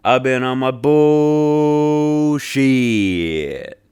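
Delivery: loud mock drawn-out hook; drawn-out chorus. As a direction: drag out the last word mockingly. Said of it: "But then he just goes".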